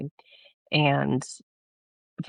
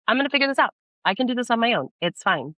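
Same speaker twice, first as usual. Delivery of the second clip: higher, defiant go-it-alone; defiant bravado; 9 semitones higher, faster than usual. Retold or imitated. Telling the Lord she'll manage without him: mock bravado. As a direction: brush God off with mock confidence. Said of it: "I was so, like, harsh".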